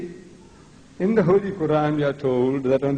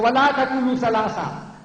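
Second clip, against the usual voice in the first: raised, emphatic declamation; forceful commanding recitation; 5 semitones higher than usual. Shown as a demonstration. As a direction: deliver the verse as a stern order. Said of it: "This is how Allah talks".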